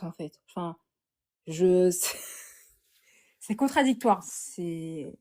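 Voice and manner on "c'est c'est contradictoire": ordinary talk, nothing marked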